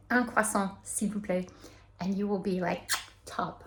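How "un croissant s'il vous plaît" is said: easy French bakery order; French "un croissant". Shown as a demonstration. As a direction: order "Un croissant, s'il vous plaît" like a local. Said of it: "you can say".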